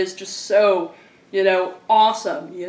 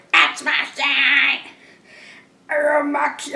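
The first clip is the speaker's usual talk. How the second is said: high, drawn-out mock rock shout; gruff mock shouting, pitched way up; 6 semitones higher, slower than usual. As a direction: imitate the record's gruff, hammed-up shouts. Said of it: "hilariously gruff shouts on here".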